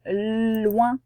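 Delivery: "Loin" with a long held L; drawn-out phonics demo of "Loin"; sounded out. Shown as a demonstration. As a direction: linger on the L of "Loin" before "ouin". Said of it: "il n'y a qu'un seul autre son".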